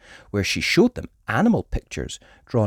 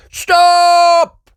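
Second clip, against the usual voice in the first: much higher, loud sudden yell; 12 dB louder than usual. A narrator's reading. Mister Bison yelling to halt the car, loud and sudden. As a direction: bark a warning. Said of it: "yelled Mister Bison".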